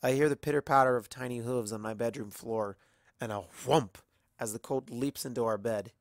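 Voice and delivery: plain reading voice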